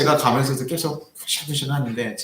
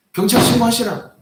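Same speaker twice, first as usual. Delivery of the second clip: higher, louder dare; defiant shout; 9 semitones higher, 5 dB louder than usual. Retold or imitated. Pastor daring the old man to call the police, loud.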